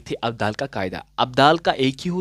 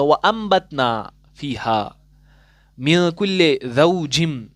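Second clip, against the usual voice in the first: slow, drawn-out recitation with nasal ghunna; slow Quran recitation; slower than usual. Shown as a demonstration. Teacher recites the verse slowly for students, tajweed style. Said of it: "यहाँ पर क्या मैं पढ़ूँगा?".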